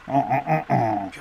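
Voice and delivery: usual speaking voice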